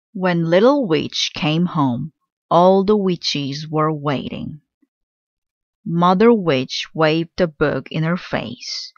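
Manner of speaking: usual speaking voice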